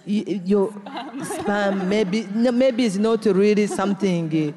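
laughing. Real, not acted. Activist recalling suspected spam call to audience, laughing. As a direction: laugh as you recount your doubt.

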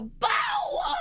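sudden high scream. Retold or imitated. Wife fed up, screams to wake husband. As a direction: blast it to wake him. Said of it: "I screamed out loud".

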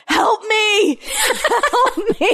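high-pitched mock shout for help; retold child's scream. Kid blurting out during a Zoom adoption hearing, mock scream. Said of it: "he just starts screaming".

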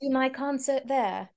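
put-on a light British accent. Guest testing her British accent on the London host. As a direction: playfully try out a British accent. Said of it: "How's my accent?".

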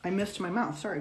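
ordinary delivery

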